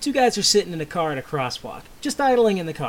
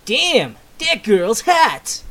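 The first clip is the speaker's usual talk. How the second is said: slow appreciative line; 6 semitones higher, 4 dB louder, much slower than usual. Acted. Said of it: "and says".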